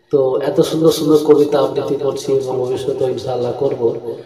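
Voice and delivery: ordinary talk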